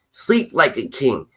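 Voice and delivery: ordinary talk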